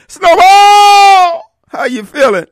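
high, loud cry of his name; loud crying call to Snowball. Acted. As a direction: cry out to the battered friend. Calling to beaten friend.

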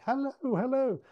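high, sing-out grandma greeting; friendly grandma. Retold or imitated. Grandma offering tea to Six, sweet high voice. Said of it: "acting like a kind of a friendly grandma".

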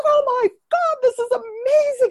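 very high, excited squeal; Oprah flipping out. Retold or imitated. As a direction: gush like a thrilled talk show host. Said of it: "flipping out".

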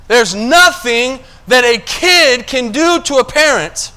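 full-force sermon delivery; preaching. Acted. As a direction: drive the point home to the congregation.

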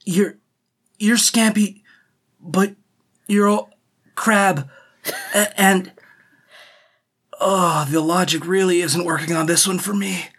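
halting, confused questions; puzzled Walt. Acted.

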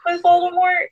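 high, boastful mock whine; smug Harry. Harry boasting to friends, high smug mock whine. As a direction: brag like you earned it. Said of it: "Harry turns around and is like".

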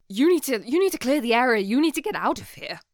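loud, high-pitched knight's order; urgent shouted warning. Acted. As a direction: shout them out of a battle zone. Knight urgently orders player away from battle.

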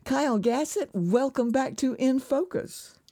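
usual speaking voice

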